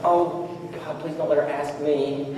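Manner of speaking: hushed, slowed inner plea; silent desperate prayer. Retold or imitated. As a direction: pray under your breath that she won't ask. Silent panicked prayer in church visit.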